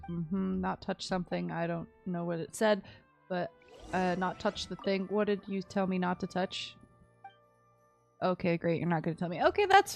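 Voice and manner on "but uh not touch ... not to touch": ordinary talk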